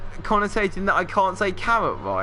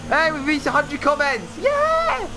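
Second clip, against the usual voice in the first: much higher, jubilant whoop; excited cheer; 11 semitones higher, slower than usual. Real, not acted. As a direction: celebrate the milestone with a big whoop. Celebrating hundred comments.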